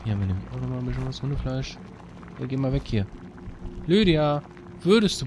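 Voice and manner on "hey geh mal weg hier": ordinary talk, nothing marked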